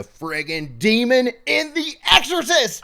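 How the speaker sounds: rising to an excited shout. Real, not acted. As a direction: build to a big reveal. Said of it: "revealing the big twist".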